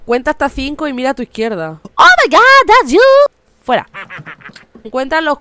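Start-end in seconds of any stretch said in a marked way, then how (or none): 2.0-3.0 high, loud startled cry; sudden fright at the gnome; shocked shriek. Real, not acted. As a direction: jump out of your skin. Scared by gnome appearing.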